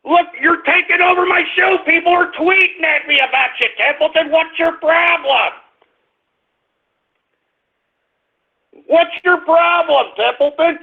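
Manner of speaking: real annoyance, voice jumps up; exasperated scolding. Real, not acted. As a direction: lose patience with the dog mid-show. Host yelling at his dog.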